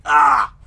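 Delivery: loud, sudden yelp of pain; pained cry. Acted. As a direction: cry out as if hit in the knee. Voicing a figure hit in knee.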